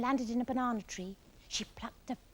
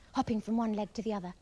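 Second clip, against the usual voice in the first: ordinary delivery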